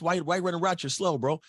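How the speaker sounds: high, jeering taunt; mocking teammates. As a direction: needle him like a teammate poking fun. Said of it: "people make fun of you".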